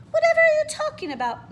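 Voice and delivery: puzzled, half laughing; surprised question. Acted. The dance teacher asks Clara what she means, surprised.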